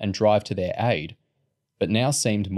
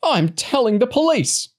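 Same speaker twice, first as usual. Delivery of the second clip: shrill outraged shout, much higher and louder; old woman shouting threats at Lauren; 5 dB louder than usual. A narrator's reading. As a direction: yell it at the girl like a furious old woman. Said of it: "the woman shouted".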